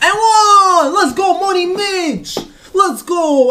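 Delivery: high, loud celebration yell; ecstatic shout. Real, not acted. Gamer cheering Money Mitch's and-one, ecstatic shout. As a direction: scream it like the game just swung.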